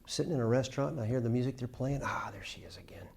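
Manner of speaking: hushed whisper. Acted. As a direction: whisper it like a secret. Whispered restaurant aside.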